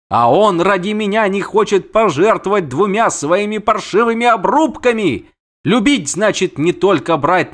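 high, reproachful siren tirade; indignant siren. Acted. Siren berates her suitor for refusing sacrifice, indignant.

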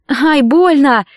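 mild acted sob of protest; sobbing protest. A narrator's reading. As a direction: cry out in pain, tearful. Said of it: "всхлипнула Кэтрин".

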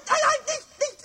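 high squealing no, no; a kobold squeaking in alarm; frantic protest. Acted. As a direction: shriek in panic while dangling upside down. Pumuckl held upside down protests.

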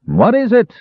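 high-pitched indignant demand; roused sleeper's high shout. A narrator's reading. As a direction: call down a demand from the balcony. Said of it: "demanded a man in a pointed nightcap".

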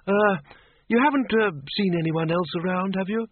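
high, halting character voice; hesitant character question. Acted. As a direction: ask haltingly, pitched high. Windle asks the sergeant hesitantly.

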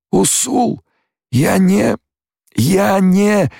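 very high, halting voice; bewildered follower. A narrator's reading. Stilgar failing to understand Paul, bewildered. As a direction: stammer it high, breaking off.

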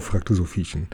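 ordinary delivery

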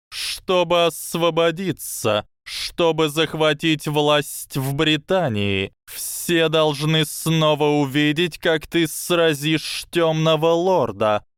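high, slow serpent voice; a hissing snake, slow and sly. Acted.

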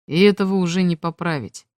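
ordinary delivery, nothing marked

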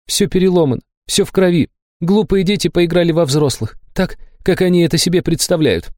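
a hint of a shocked girl scolding; Liza, upset. A narrator's reading. (Лиза, горестно и с упрёком)

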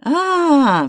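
high, drawn-out indignant "aah"; angry cry. A narrator's reading. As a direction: cry out long and high in anger. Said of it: "вскричал рассерженный поляк".

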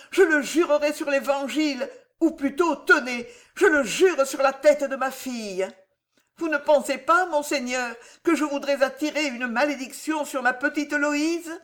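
put-on begging a hard old man for pity, voice breaking; sad, broken voice. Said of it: "d'une voix si triste et si brisée".

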